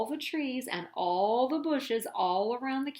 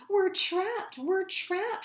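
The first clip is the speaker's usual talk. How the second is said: panicked shout; 9 semitones higher, slower than usual. A narrator's reading. The Grunts shouting as rocks block the cave, panicked. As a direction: cry out in terror. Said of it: "shouted the Grunts".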